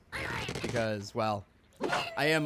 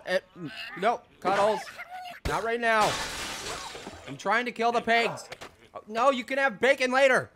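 mildly raised pet-scolding voice; talking to pet; 7 semitones higher than usual. Real, not acted. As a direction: gently shoo the pet away.

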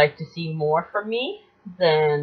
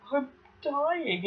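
crying; 8 semitones higher, 9 dB quieter than usual. Retold or imitated. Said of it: "I'm crying because I'm like".

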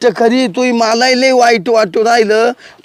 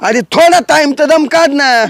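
raised voice; 5 semitones higher than usual. Acted. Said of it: "मग ओरडतो कशाला?".

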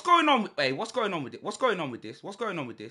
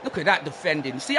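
ordinary talk, nothing marked

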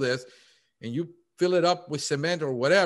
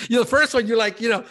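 laughing through words; 9 semitones higher, 7 dB louder, faster than usual. Real, not acted. Laughing while talking.

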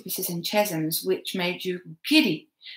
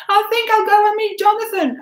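high, thin, frightened voice; scared and feeble; 14 semitones higher, 8 dB louder than usual. A narrator's reading. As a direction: try to sound brave and fail. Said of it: "how scared and feeble I sounded".

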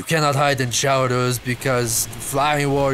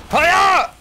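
high, loud martial-arts yell; piercing play-fighting shout; battle cry; 14 semitones higher, 7 dB louder than usual. Pretend. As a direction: yell a hammed-up karate attack cry. Attacking as Flying Warrior.

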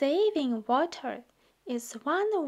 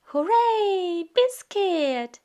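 high, loud hooray; happy cheer; 5 semitones higher, 5 dB louder than usual. Acted. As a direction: cheer the puppy on with delight. Cheering the puppy on.

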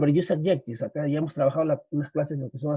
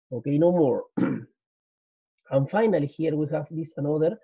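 ordinary delivery

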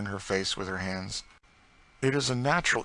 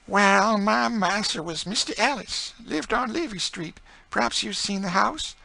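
much higher, slow woman's voice; grieving enslaved woman; 7 dB louder, slower than usual. Acted. Enslaved woman telling Emmeline about her old master, high and mournful.

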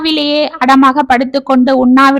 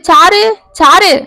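slow, loud, drawn-out call of the name; 5 semitones higher, 4 dB louder, slower than usual. A narrator's reading. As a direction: call her from the doorway. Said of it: "என்று அழைத்து கொண்டு".

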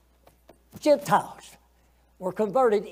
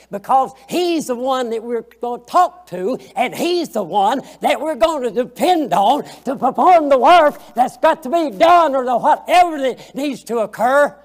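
rising louder and louder into a preacher's cry; preaching at full force; 4 semitones higher, 10 dB louder than usual. Acted. Preacher lifting his voice to the congregation, loud and rising. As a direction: build the sermon to a loud climax.

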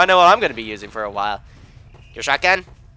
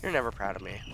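usual speaking voice

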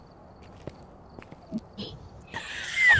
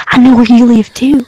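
louder, a sudden menacing burst; loud threat; 12 dB louder than usual. Pretend. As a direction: shout the threat to startle. Threat repeated, first take loud.

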